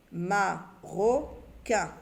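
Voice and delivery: Marocain stretched very slowly; drawn-out word. Shown as a demonstration. Teacher to pupils, stretching out the finished word. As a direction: spell the word out with your voice. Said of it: "Ma, quin, ro".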